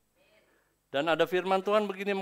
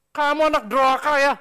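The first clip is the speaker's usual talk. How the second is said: loud, higher angry rebuke; cursing scold; 11 semitones higher, 14 dB louder than usual. Retold or imitated. Angry parent cursing a child who talks back, loud harsh rebuke. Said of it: "itu nakal mengutuk namanya".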